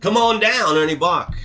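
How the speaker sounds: high, loud TV car-ad pitchman call; imitating the dealership ad on TV; Ernie Bach ad impression. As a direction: belt it like the local dealer's TV jingle. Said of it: "they know that".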